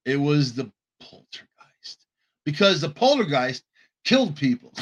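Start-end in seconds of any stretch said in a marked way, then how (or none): 1.0-2.0 hushed, dramatic whisper; spooky whisper. Acted. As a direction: breathe it like a secret. Dramatic reveal of the movie.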